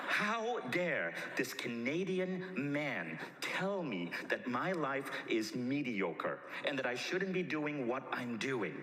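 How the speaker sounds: slow, drawn-out mock indignation; retold furious. Speaker fumes inwardly at this Canadian man, slow hammed-up fury. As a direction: stretch every phrase in affronted outrage. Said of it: "I was so frickin' furious".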